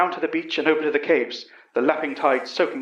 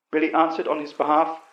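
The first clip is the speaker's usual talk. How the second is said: plain reading voice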